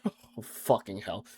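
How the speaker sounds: usual speaking voice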